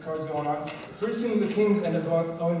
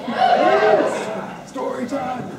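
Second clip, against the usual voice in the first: high, loud eager yes; mock crowd excitement; excited cheer; 9 semitones higher, 8 dB louder, much slower than usual.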